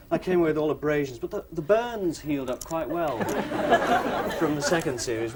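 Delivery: ordinary talk, nothing marked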